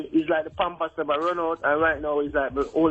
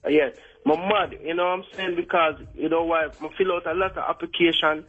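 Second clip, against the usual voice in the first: fake Jamaican accent. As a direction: put on a thick Jamaican accent. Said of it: "You sound like [name] pretending to be Jamaican".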